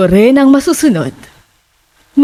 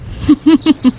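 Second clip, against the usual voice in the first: eerie chuckle; 6 semitones higher than usual. Acted. Landlady laughs after welcoming tenants.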